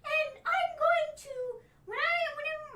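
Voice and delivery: high-pitched fake sobbing, loud; wailing sob-voice impression of Sasha Banks; crying impression. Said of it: "Sasha Banks crying impression".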